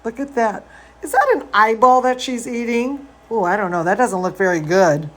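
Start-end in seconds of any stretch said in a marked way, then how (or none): none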